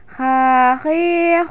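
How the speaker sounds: slow drawn-out recitation drill; chanted throat-letter model; swung syllables. Shown as a demonstration. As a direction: stretch each vowel, scraping the kh. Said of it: "ayu ayun mana tahan".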